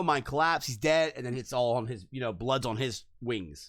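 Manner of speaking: ordinary talk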